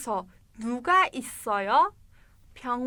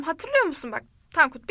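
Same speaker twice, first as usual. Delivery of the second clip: normal talking voice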